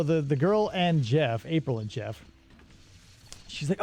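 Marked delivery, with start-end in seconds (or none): none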